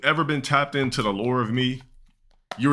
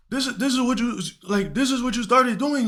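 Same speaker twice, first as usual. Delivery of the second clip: much higher put-on voice, scolding; a high, let-down teacher voice; teacher reproaching his former student for this job, in a high put-on voice; disappointed teacher. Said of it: "visually upset that I was working there".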